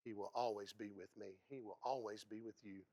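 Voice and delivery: ordinary delivery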